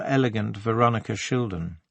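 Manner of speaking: ordinary delivery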